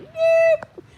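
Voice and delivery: a sudden, sharp, very high squeaky nope; flat refusal. Retold or imitated. Refusing the chicken fans a mock squeaky nope. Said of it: "I was like".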